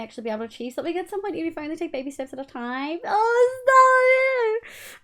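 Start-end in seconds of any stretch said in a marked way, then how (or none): 3.0-5.0 high, loud, drawn-out gushing; high-pitched, loud flattered protest; touched squeal. Real, not acted. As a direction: squeal it out, overcome by kindness. Touched by kind chat message.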